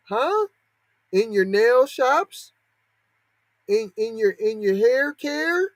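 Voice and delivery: high, slow, needling mock questions; mock questioning.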